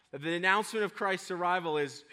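normal talking voice

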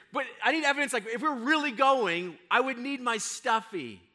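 high-pitched imitation of pleading children; insistent kids. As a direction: insist like a child needing proof. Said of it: "Now, for my kids".

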